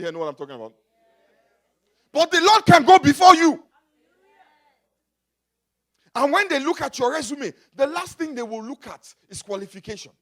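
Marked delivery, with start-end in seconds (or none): 2.1-10.1 loud soaring preacher's declaration; preaching at full force. Acted. Preacher lifting his voice to the church, loud and soaring. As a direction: lift it high and loud over the congregation.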